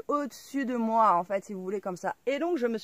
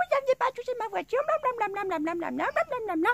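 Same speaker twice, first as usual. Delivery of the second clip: high mock voice, fast, trailing into blablabla; mocking yelling woman; 11 semitones higher than usual. Mocking the woman yelling about her car. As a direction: parody her nagging, much higher. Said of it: "elle gueule".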